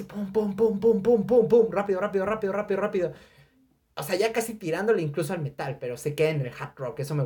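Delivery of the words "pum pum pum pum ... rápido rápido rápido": galloping beat. Retold or imitated. Imitating a galloping horse rhythm.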